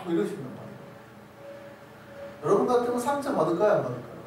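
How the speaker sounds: usual speaking voice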